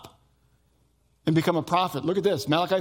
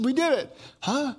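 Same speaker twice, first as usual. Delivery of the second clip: bright mock triumph, pitched higher; higher teasing exchange between husband and wife; higher playful exclaim; 8 semitones higher than usual. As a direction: sell the punchline up high.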